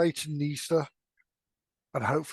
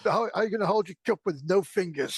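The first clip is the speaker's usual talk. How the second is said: high-pitched mock interrogator; teasing character impression, pitched up; Bracy; 5 semitones higher, 3 dB louder than usual. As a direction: raise the voice for a playful in-character taunt. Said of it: "I can imagine Bracy saying".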